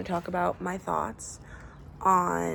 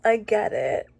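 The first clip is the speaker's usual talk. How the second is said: tearful; 6 semitones higher, much slower than usual. Real, not acted. Tearful after finishing book.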